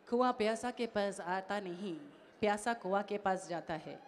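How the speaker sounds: a hint of film-hero gravity; Mohan Babu dialogue. Host quoting a Hindi dialogue line to the shy fan, soft and slow. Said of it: "నాకు హిందీ కూడా వచ్చేసింది".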